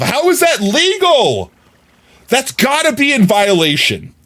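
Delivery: high, drawn-out incredulous protest; mock outrage. Streamer thinking to himself about the ad, outraged protest. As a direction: exclaim it in indignant disbelief.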